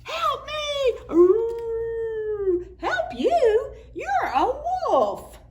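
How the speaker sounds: high, loud frightened cry; scared animal voice. Acted. Frightened critter crying for help in the story. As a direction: squeal it in panic.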